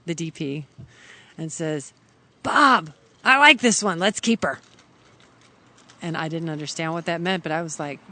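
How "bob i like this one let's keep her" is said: loud, higher director's call across the set; imitating a boss calling over a crewmate. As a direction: announce it to a colleague, raised voice.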